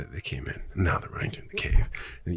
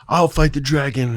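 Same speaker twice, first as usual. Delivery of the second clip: loud, raised hero's cry; heroic declaration; 7 semitones higher, 9 dB louder than usual. Retold or imitated. Hero volunteering from the crowd, loud declaration. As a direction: call it out over a crowd.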